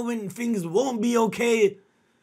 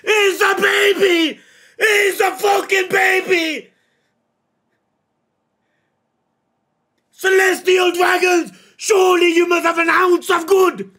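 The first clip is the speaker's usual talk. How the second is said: furious shout; 6 semitones higher, 7 dB louder than usual. Real, not acted. Outraged at the child's torture.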